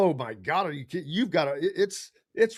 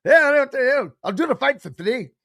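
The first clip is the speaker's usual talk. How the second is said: a mock Irish traveller accent, higher and louder; 6 semitones higher, 4 dB louder than usual. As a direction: imitate the boxer's thick Irish accent. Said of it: "the only two words I know is".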